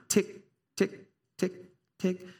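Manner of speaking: spoken tick tick of a clock. Shown as a demonstration. Pastor mimicking a clock ticking in a soft voice. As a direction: tap out the ticks like a clock. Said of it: "Time, time".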